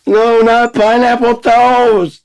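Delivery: very high, loud, slow pleading wail; mock begging. Pleading against pineapple toast, very high hammed-up begging. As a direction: beg for mercy, hammed-up.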